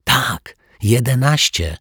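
Tak, jedenaście said high and eager with a firm fall on jedenaście; excited whisper. A narrator's reading. Zuzia eagerly confirms the cake had eleven candles. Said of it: "szeptała podekscytowana Zuzia".

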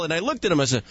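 usual speaking voice

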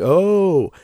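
long, loud "ohhh" rising high and falling; imitated sleep moan. Wife mimicking his sleep noise, high loud imitated moan. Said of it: "like, moaning in your sleep".

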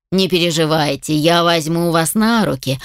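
usual speaking voice

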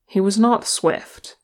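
plain reading voice

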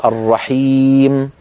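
الرحيم drawn out very slowly; slow recitation. Shown as a demonstration. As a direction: hold the long i in الرحيم. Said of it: "كل هذه الياءات". (تلاوة بطيئة)

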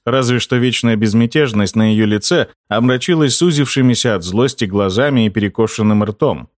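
ordinary delivery, nothing marked

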